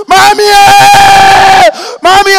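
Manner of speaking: loud high cry for mommy; child crying out. Retold or imitated. Beaten child crying out to his mother. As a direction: wail for your mother.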